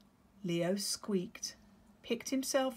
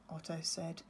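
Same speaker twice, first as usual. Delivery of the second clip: ordinary delivery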